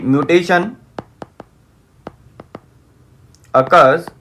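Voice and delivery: word by word, long pauses; slow dictation, pausing after each chunk; dictating while writing. Real, not acted.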